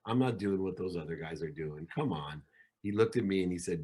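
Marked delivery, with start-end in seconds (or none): 0.0-2.4 pleading. Retold or imitated. Pleading with friend Rob.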